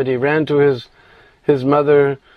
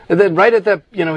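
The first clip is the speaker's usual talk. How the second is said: usual speaking voice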